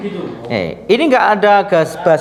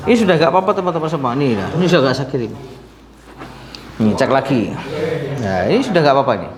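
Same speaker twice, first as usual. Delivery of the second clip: rushed report; faster than usual. Real, not acted. Quick report to viewers.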